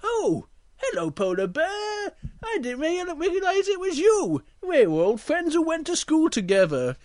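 high, stretched-out delighted greeting; surprised friendly bear. Acted. Big Bear greets an old friend.

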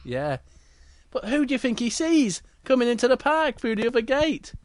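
plain reading voice

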